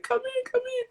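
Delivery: put-on high, urgent little-kid plea; imitated child's panic. Daughter urging mom into the bathroom, imitated child's panic. Little kids, you know, they panic over stuff.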